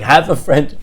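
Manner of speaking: loud, scoffing echo; mocking disbelief. Son ridicules dad's answer, mocking. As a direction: throw it back with a laugh of scorn. Son mocks father's half friend.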